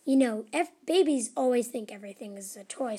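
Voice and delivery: usual speaking voice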